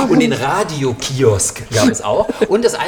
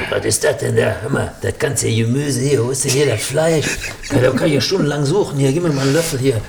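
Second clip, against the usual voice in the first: grumbling complainer voice; Peter Gedöns. Acted. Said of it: "der hätte garantiert was zu meckern gehabt".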